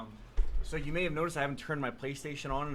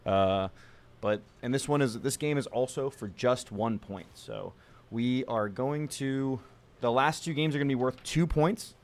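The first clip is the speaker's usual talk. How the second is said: loud hammed-up sportscaster voice; frantic mock sports commentary; 8 dB louder than usual. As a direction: announce it like a TV broadcaster. Mock championship broadcast announcing.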